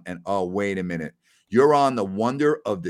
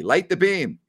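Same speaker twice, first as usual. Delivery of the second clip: rallying cry; 8 semitones higher, 4 dB louder than usual. Real, not acted.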